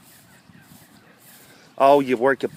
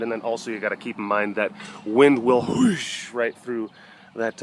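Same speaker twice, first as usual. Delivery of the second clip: ordinary talk, nothing marked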